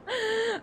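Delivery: high-pitched burst of laughter; sudden high giggle. Real, not acted. Bursts out laughing mid-game.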